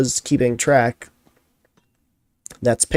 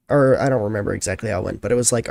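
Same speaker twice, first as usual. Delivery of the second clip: ordinary talk, nothing marked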